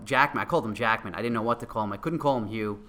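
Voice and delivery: ordinary talk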